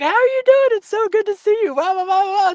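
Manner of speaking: very high, excited chatter; squealing hello to an old friend; gushing greeting. Retold or imitated. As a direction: bubble over at seeing a friend. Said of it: "I'm like".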